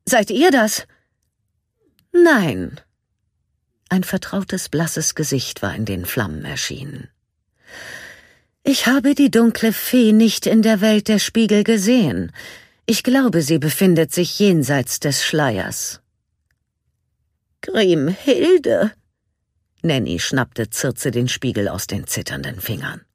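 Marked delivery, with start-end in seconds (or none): 0.1-0.9 fearful call. A narrator's reading. Circe calls into mirror. 2.1-16.0 high, loud, haughty queen voice; cold evil queen. A narrator's reading. Evil queen speaks from mirror. 17.6-18.9 startled cry. A narrator's reading. Nanny recognises queen.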